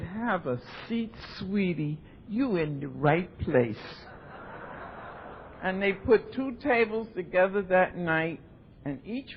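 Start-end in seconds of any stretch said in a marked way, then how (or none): 0.0-4.1 slow, gentle welcome; tender reassurance. Retold or imitated. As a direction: soothe her into her seat, slow and soft. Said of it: "He said".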